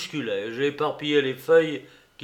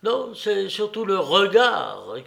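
higher, louder, emphatic protest; quick sharp retort; 6 semitones higher, 4 dB louder than usual. A narrator's reading. Alienist correcting the narrator about the gaze, sharp retort. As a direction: retort with sudden intensity, leaning on "regard". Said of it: "répliqua vivement l'aliéniste".

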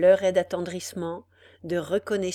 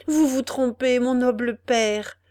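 emboldened; 5 semitones higher, 7 dB louder than usual. A narrator's reading. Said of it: "ajouta Ethel enhardie".

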